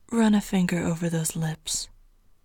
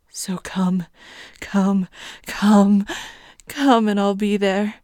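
insistent, louder commanding chant; urgent urging; 6 dB louder than usual. Acted. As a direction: drive it home, rising each time. Repeated urging, getting louder.

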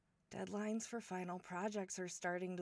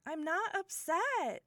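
high, loud, drawn-out protest; defensive denial; 7 semitones higher, 7 dB louder, slower than usual. Acted. Denying hurt to brother's friend who is leaving, defensive protest. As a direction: protest too much, voice raised. Denies being upset too strongly.

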